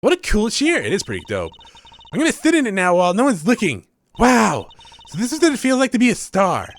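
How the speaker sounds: put-on high, bright young magician girl; voicing the girl sidekick, perky and high; cheery girl voice. Voices Trucy in game dialogue.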